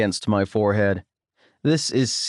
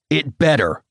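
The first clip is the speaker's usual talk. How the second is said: growling; 4 semitones higher than usual. A narrator's reading. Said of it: "he growls".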